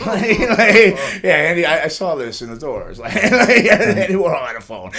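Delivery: high, loud giggling talk; amused, laughing at the memory. Real, not acted. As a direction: keep laughing as you talk. Laughing over his story.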